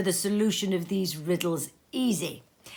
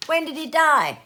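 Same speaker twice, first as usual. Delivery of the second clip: higher, louder eager question; raised, eager merchant voice; curious businessman; 9 semitones higher, 8 dB louder than usual. Acted. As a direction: ask it keenly, pitched up. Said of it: "inquired another".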